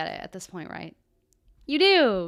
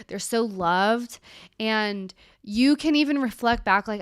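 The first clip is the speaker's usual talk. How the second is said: normal talking voice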